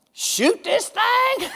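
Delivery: high, slow mock whoop; mock hollering folks. Preacher mimicking folks with new toys, hammed-up slow whoop. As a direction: drawl it out like a giddy buyer. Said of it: "I know some folks".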